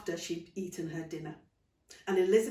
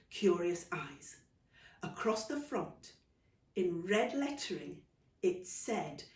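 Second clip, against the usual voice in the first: ordinary delivery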